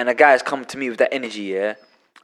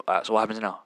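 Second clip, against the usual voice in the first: low-key challenge, softer and lower; confrontation without shouting; 4 semitones lower, 8 dB quieter, faster than usual. Acted. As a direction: square up quietly, no shouting. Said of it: "matching someone's energy without shouting".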